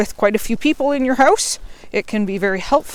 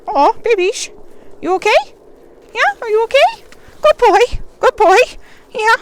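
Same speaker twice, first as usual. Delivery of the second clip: much higher and louder happy praise; 6 dB louder than usual. Real, not acted. Owner comforts and praises her puppy, high-pitched praise voice. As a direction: gush over the puppy.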